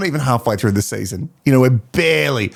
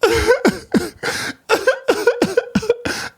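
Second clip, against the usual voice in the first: high-pitched mock sobbing; exaggerated fake cry; imitated crying. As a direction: fake a burst of high, stuttering sobs. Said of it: "to see if they cry like this".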